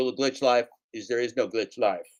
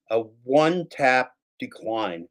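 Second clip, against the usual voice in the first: ordinary talk, nothing marked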